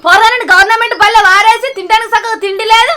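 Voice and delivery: loud, bitter scolding; angry scolding. Acted. Wife scolding her drunk husband over his lies, loud. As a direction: scold him hard and fast.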